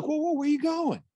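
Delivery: high, mock-friendly call to stop someone leaving; lightly voiced sly teacher calling the visitor back; spider to the fly. Teacher stops the guest leaving, high mock-friendly call. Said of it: "Said the spider to the fly".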